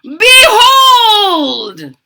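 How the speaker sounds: high, loud, slow triumphant shout; booming mad scientist triumph; mad scientist cry. Acted. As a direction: crow it like a mad doctor. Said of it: "Doctor Franken cried".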